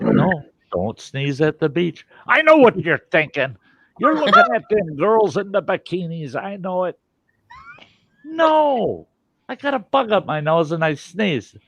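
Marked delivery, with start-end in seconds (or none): 2.3-7.0 accusing wife. Retold or imitated. Wife accusing him, voiced. 8.2-11.6 defensive protest. Retold or imitated. His own defense, raised voice.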